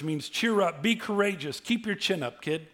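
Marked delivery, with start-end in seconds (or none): none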